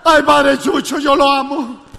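mock gushing lady; gushing fan. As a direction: coo at him like a starstruck admirer. Lady gushing over the priest.

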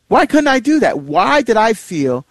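high-pitched self-questioning; incredulous at your own behavior; exasperated self-reproach. Real, not acted. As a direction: plead with yourself in frustration.

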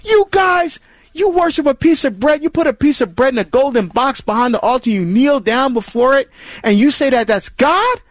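high, mocking challenge from a critic; imitating a hostile critic, pitched high; hostile questioner. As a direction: voice the accuser with a sneer. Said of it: "those questions may be hostile".

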